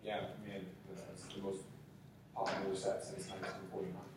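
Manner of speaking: bored manager voice. Retold or imitated. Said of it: "He's like".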